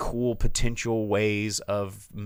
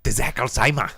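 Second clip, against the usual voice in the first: put-on German accent, punched up for the joke; hammed-up German; 3 semitones higher, 4 dB louder than usual. Host repeats the title to a laughing co-host, hammed-up German accent. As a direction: say the title with mock German force. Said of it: "You got a problem with German".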